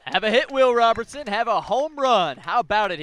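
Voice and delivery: thrilled commentator cheering the tying run; excited celebration. Real, not acted. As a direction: whoop it up for the home team. Color man celebrates the home run.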